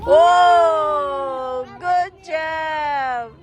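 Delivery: loud, delighted whoa; excited cheer. Real, not acted. Cheering kid's fast slide.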